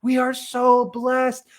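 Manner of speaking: high, drawn-out tearful gushing; mock weepy, high and stretched out; imitated tearful joy. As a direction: act overwhelmed with gratitude, slowly. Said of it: "I was crying. I'm like".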